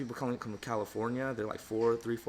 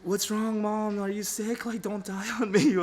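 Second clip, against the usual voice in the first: high, loud mock-worried child calling to mom; mock worried kid; 10 semitones higher, 8 dB louder than usual. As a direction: play the kid panicking that mom is sick. Teenage self talking to sick mom.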